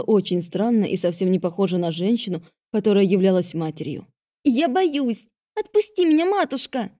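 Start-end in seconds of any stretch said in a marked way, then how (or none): 4.4-6.9 high squeaky pleading. A narrator's reading. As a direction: squeak it out, frightened. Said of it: "писклявым голосом попросила Делия".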